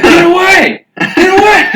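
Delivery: high, loud mock panic; imitated screaming. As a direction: scream it like a terrified driver. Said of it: "And he just scream".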